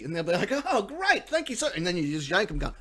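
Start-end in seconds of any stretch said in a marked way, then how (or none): 0.5-1.7 high-pitched mock gratitude; grateful victim. Prank victim thanking the pirate, mock-grateful. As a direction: thank them in a put-on higher voice.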